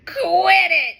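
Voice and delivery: loud whining yelp; crying dog. Acted. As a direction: beg it to stop loud. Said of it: "cries the dog".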